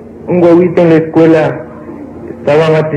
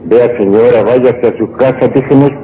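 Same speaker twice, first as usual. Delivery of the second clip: lower-pitched, restrained husband; exasperated son-in-law; 6 semitones lower than usual. Acted. Husband sending mother-in-law home, restrained exasperation. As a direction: lightly play a husband warning her off.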